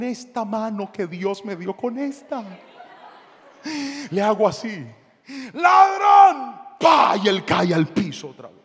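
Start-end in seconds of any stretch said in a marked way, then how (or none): 5.5-7.2 grito agudo y fuerte; suéltalo como un golpe. Retold or imitated. Pastor golpea al ladrón. (grito al ladrón)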